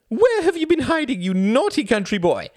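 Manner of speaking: very high, loud, coy party woman; pouting. Acted. Greta scolds Klaus playfully, pouting in a high voice. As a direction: tease him like a naughty child. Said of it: "she pouted".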